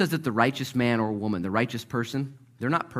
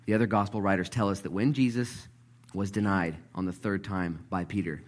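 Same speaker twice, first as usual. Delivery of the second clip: ordinary talk, nothing marked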